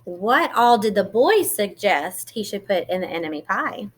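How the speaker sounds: plain reading voice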